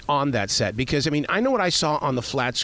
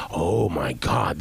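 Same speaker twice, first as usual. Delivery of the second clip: low, drawn-out voicing of a viewer; awed viewer reaction; 9 semitones lower, much slower than usual. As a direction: imitate a viewer's slow, deep "oh my God". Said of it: "where the viewers can go".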